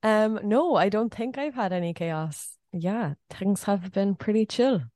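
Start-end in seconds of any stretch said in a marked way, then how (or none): none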